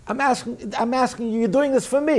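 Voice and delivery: high-pitched, insistent appeal; acted insistent plea. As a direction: press the personal request in a high voice. Voicing God's insistent personal request.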